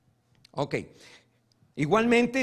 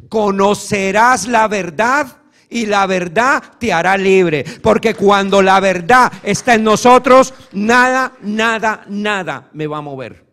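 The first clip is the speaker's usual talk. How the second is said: full-force preaching; 2 semitones higher, 13 dB louder than usual. Real, not acted. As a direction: drive it home to the congregation.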